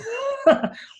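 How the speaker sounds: sudden high squeal of laughter; high burst of laughter. Real, not acted. Host laughs at the butterfly farmers nickname, high squeal.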